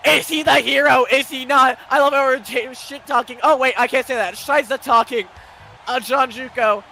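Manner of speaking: loud, high hyped commentary; excited commentary. Real, not acted. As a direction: still riding the goal, keep it loud. Hyped commentary after the goal.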